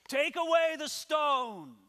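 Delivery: loud, high, drawn-out command. Acted. Jesus at the tomb ordering the stone moved, commanding. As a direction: proclaim it slowly as an order.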